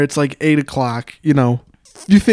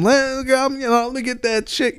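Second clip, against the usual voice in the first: higher, softer slurred mumble; mock mumbling; 7 semitones higher, 6 dB quieter than usual. Man mumbles at our table for chicken, mock mumbling. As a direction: imitate a man mumbling his request. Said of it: "he starts mumbling something".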